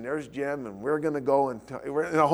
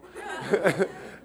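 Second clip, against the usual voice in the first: amused laugh; 4 semitones higher than usual. Real, not acted.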